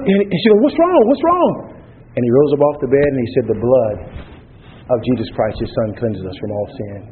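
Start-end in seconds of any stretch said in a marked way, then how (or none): 0.6-1.5 frantic cry pitched much higher; panicked mother rushing in. Retold or imitated. Mother bursts into the room fearing her husband is dead, in a high panicked cry. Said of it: "in a panic". 3.5-7.0 calm conviction. Retold or imitated. Husband wakes and quotes the verse.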